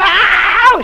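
high, loud scream. Retold or imitated. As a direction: cry out in a retold scream. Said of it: "She was screaming".

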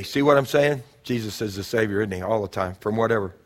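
ordinary talk, nothing marked

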